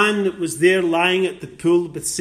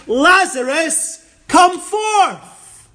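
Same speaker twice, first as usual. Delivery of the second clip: ringing high, loud call to the dead man; powerful call; 11 semitones higher, 6 dB louder, much slower than usual. Acted. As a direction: call him out of the tomb with power. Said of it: "He calls in power with the voice".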